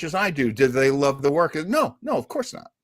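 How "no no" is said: usual speaking voice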